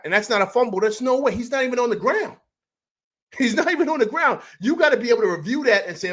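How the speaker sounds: emotional. Real, not acted. Fan disputing a missed fumble call, emotional. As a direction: argue it high and heated, really upset. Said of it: "I'm emotional right now".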